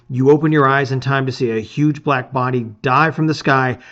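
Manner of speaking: ordinary delivery, nothing marked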